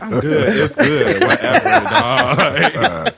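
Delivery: high, laughing mock brush-off; high-pitched laughing impression of the cashier; mocking Connie. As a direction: squeal it out through laughter, imitating her. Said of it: "She was like".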